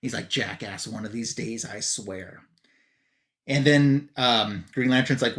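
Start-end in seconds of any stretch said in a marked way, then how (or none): 0.3-2.4 low, hushed threat under the breath; retold grumble. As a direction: mutter it low and quiet. Batman grumbles at Superman's taunt.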